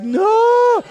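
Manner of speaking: very high, loud imitated child scream; a kid's long, panicked shriek, acted out; freaked-out wail. Son hears he needs a shot, mock screaming. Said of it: "He's freaking out".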